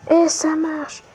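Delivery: high joyful exclamation; excited cheer. Real, not acted. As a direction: shout it out in triumph. Craft works, cheer. (cri de joie)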